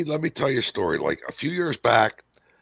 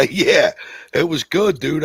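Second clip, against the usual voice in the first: laughing yeah; 6 semitones higher, 6 dB louder, slower than usual. Real, not acted. Laughing about the whiskey coffee.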